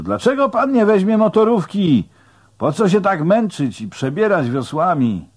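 high, loud shouted question; calling out. A narrator's reading. Onlooker on shore questioning the man rowing, calling out. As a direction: call across the water so the rower hears. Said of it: "zawołał ktoś stojący na nabrzeżu".

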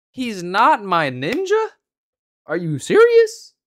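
slow, then a loud high squeal of disbelief; hammed-up shock, pitch shooting up; exaggerated disbelief. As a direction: act floored by the excuse. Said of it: "I was exaggerating a little bit for a video".

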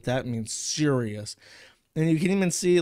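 ordinary talk

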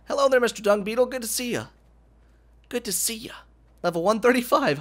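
high greeting with the voice cracking up. Real, not acted. Gamer greeting a dung beetle, voice cracking high. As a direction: let the pitch jump and crack as you greet. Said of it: "my voice just keeps cracking".

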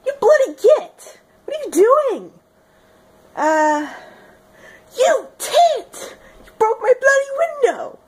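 very high, indignant mock British screech; hammed-up angry British voice; bad British accent. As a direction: scold someone in a squeaky put-on English accent. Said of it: "I can't do the accent".

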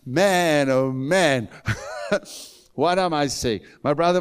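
high, loud preaching with shouted questions; full-force preaching. Acted. Preacher exhorting the congregation about life in Christ: loud, high preaching. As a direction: preach it out to the crowd, rising.

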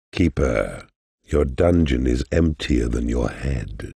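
ordinary delivery

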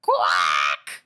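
harsh, drawn-out quack; rasping shouted duck cry; duck impression. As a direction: squawk it like a duck. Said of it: "Do you like my impression of a duck?".

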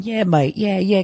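mocking a loudmouth Englishman on his cell phone, in a high English accent; English bloke impression. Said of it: "Still talking".

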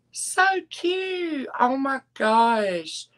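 squealed high, delighted; gushing. Real, not acted. Host gushing to viewers over a cute top.

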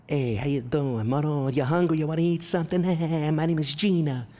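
loud, high Italian mamma caricature; very Italian mother. Retold or imitated. Imitating his tiny Italian mother, loud high exuberant greeting. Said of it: "She was so Italian".

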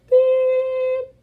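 high loud censor beep. Shown as a demonstration. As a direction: bleep it out yourself. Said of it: "so I'm gonna".

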